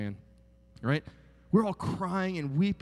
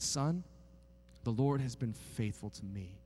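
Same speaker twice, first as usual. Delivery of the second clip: soft, low, tender father's voice; tender words to son; 7 semitones lower, 7 dB quieter than usual. Retold or imitated. Father tells his son at the fire, tender and soft.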